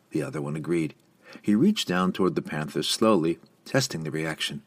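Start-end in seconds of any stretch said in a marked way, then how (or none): none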